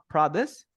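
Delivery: produce said PROD-uce; stress on first syllable. Shown as a demonstration. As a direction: hit the start of produce. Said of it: "the stress goes first here".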